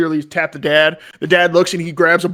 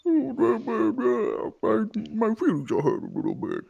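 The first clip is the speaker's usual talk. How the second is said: softer, higher sulky mumble; sulky yak; 5 semitones higher, 7 dB quieter than usual. Acted. Yak sulking at the paladin, softer sulky voice. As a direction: pout like a hurt animal.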